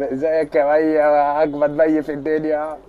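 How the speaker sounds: slow, stretched-out hello to a fan; playful over-the-top greeting, slowly drawn out; effusive drawn-out greeting. Pretend. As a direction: draw out every name to make up for a missed greeting. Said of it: "كل دول ازيك ومفيش ازيك".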